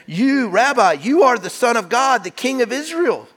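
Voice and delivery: high, loud cry of wonder; awed exclamation. Retold or imitated. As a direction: proclaim it like a stunned believer. Nathanael's awed exclamation retold.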